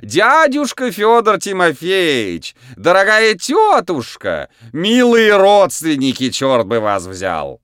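high, loud, slow showman voice; booming circus ringmaster. A narrator's reading. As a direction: ham it up for the crowd. Clown greets animals in ring.

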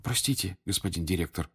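soft, guilty apology; apologetic. A narrator's reading. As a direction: sheepishly excuse yourself. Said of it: "виновато глядя на Дамблдора". (виновато)

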